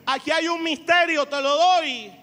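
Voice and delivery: loud, slow, emphatic sermon delivery; full-force preaching. Acted. Pastor exhorting his congregation, loud and emphatic. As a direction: preach it out to the whole church.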